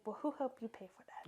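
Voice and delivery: hushed, unvoiced retort; whispered quote. Friend quoting her jab about equipment, whispered. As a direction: breathe it out as a sly jab. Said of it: "She's like".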